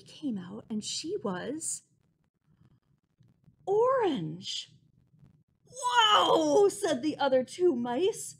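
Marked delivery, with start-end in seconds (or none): 5.7-6.7 amazed whoa. Acted. Mice amazed at orange.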